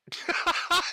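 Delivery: sharp high burst of laughter; high cackle. Real, not acted. Laughs at his own mockery.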